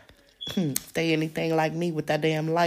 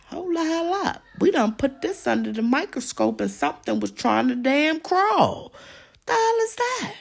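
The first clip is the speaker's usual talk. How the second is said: much higher, startled voice of a scientist at a microscope; alarmed scientists; 11 semitones higher than usual. Retold or imitated. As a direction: squeak it out like a shocked lab scientist. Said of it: "My good scientists from the top schools".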